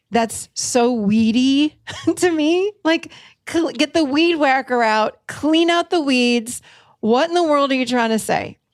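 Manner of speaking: playing the baffled listener; mock exasperation. Coach mimics a baffled listener, exasperated and high. Mocking reaction to vague talk.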